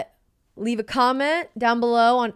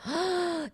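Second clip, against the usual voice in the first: high gasp of realization; sharp gasp; 8 semitones higher, 4 dB quieter than usual. Real, not acted. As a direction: gasp as it dawns on you. Realizing the plot twist.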